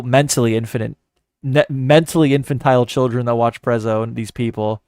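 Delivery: normal talking voice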